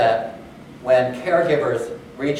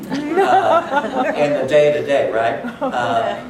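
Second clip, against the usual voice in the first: laughing; 7 semitones higher, 4 dB louder, much slower than usual. Real, not acted. Teasing the two hosts.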